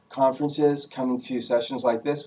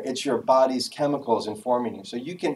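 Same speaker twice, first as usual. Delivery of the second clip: ordinary talk, nothing marked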